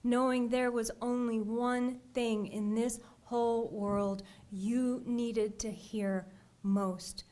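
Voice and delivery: usual speaking voice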